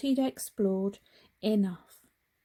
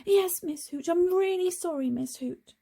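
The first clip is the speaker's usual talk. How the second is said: relieved; 9 semitones higher, 4 dB louder than usual. Acted. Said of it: "said Frog in a relieved voice".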